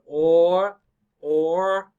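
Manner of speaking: or held long with an R; R-coloured or. Shown as a demonstration. Teacher models the vowel, or drawn out with R. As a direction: stretch or, sounding the final R. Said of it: "some people say or".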